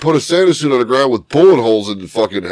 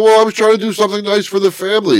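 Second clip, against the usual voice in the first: fast, higher self-defending plea; rushed defense; 8 semitones higher, much faster than usual. Retold or imitated. Husband defending his tree purchase to his wife, fast high plea. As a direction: rattle it off defensively. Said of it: "I go".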